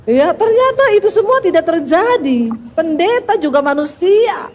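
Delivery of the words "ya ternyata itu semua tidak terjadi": normal talking voice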